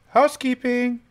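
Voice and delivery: high, loud sing-song housekeeping call; mock housekeeper call. Gamer jokingly calling into the creepy house. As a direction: announce yourself like a hotel maid.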